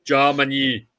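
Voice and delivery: bad, hammed-up Austrian action-star announcer; badly faked Austrian accent, announcing grandly; terrible Arnie. Announcing Eurovision votes as the action star, terrible impression. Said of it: "That's a terrible Arnie impression".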